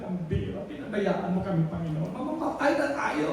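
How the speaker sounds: frightened hush. Acted. Terrified disciples in the storm.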